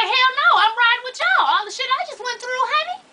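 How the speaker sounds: high, loud hell no; angry. Retold or imitated. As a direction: shout the refusal furiously. Angry refusal to staff.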